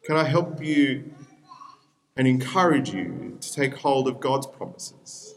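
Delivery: normal talking voice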